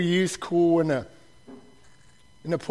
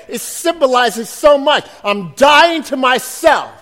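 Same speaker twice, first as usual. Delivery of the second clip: loud, emphatic sermon delivery; preaching at full force; 5 semitones higher, 7 dB louder than usual. Acted. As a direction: drive each phrase home to the church.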